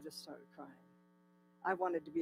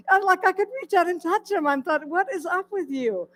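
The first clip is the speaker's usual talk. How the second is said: amazed disbelief; 11 semitones higher, 11 dB louder than usual. Retold or imitated. Amazed at the bird up close.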